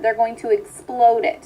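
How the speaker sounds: normal talking voice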